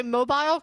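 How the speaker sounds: "mobile" as MO-bile, long I; MO-bile pronunciation. Shown as a demonstration. Streamer asks chat how they say it, "mobile" as MO-bile. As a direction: ask "mobile" to rhyme with file. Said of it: "Like, do you say".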